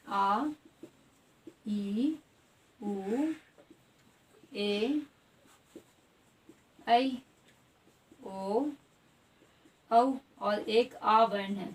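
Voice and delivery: each long vowel held, with long pauses between; long vowels demonstrated. Shown as a demonstration. Teacher lists the long vowels for students, slowly. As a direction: sound out आ, ई, ऊ one at a time. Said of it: "ये सारे हैं आपके दीर्घ स्वर".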